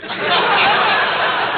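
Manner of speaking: a high breathy burst of laughter; high airy laugh at the joke; hearty laugh. Real, not acted. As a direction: laugh out loud at the idea. Laughs at his planting plan.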